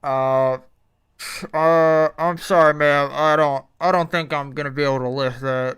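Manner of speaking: sleepy drive-thru employee over the speaker. Acted. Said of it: "a drive-through voice".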